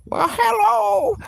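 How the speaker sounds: put-on imagined elderly self saying hello; old lady voice. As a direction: put on a sweet old woman's voice. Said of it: "I'm always joking about that voice".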